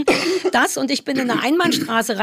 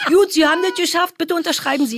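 raised-pitch official announcing a pass; imitated examiner; 6 semitones higher than usual. Examiner asking her to sign right after the test, high imitated voice. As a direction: mimic an examiner handing over the result, pitched up.

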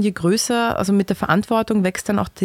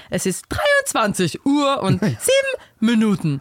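slow, higher talking clock voice; time announcement voice; 4 semitones higher, slower than usual. Retold or imitated. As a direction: recite it like the old talking clock. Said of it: "wenn man bei der Zeit angerufen hat".

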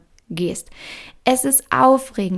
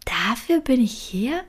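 disillusioned doubt; 4 semitones higher than usual. Retold or imitated. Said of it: "mal sagen".

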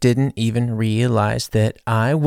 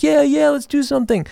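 much higher, chirpy agreement; mock eager; 3 dB louder than usual. Tired colleagues faking enthusiasm for partying. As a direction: sound eager but empty. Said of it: "everyone was like".

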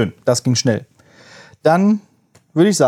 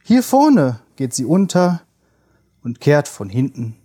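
slow, measured poem reading; poem recitation; slower than usual. Acted. Reads Heine poem aloud.

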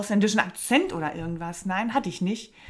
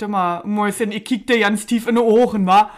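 mock Berlin guy; 8 dB louder than usual. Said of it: "so ein Typ kommt und sagt".

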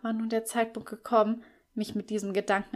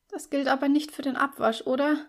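weak, pleading; 6 semitones higher than usual. A narrator's reading. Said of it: "fragte ich schwach, beinahe bettelnd".

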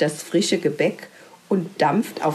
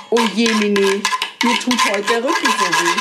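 whiny rat voice; faster than usual. Acted. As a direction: moan about an aching back, rushed. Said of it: "Auch die Ratte sagt".

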